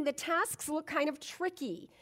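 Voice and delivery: usual speaking voice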